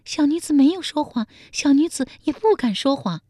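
high, pleading maid voice; terrified palace maid. A narrator's reading. Palace maid denying a lie to the emperor, terrified. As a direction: protest fearfully in a high-pitched voice. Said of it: "宫女面如涂蜡说".

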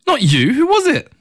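high-pitched, open-mouthed astonishment; surprised. A narrator's reading. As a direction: ask it in wide-eyed surprise. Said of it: "opened his mouth wide in surprise".

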